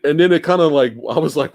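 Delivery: ordinary talk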